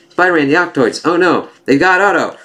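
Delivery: high-pitched urgent warning; acted alarm. Warning Spider-Man that Otto is taken, high mock alarm. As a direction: raise the alarm, lightly hammed up.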